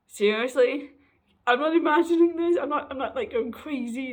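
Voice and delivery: loud, high incredulous outburst easing off; excited disbelief. Retold or imitated. Retelling their reaction to friends noticing the change, excited disbelief. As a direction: burst out as if you can't believe it. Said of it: "And I'm just like".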